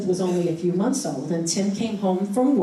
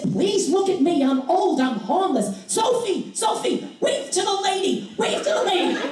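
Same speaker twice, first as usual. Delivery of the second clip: put-on much higher, wheedling old man calling out Sophie; beg, then call across to his wife in the car; old man begging and calling his wife to wave; pleading old man calling; 13 semitones higher than usual. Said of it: "And he said".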